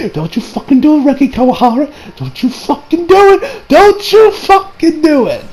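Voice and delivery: high, slowing emphatic threat; mock warning. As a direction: warn by name, stretching the words out. Acted warning to author.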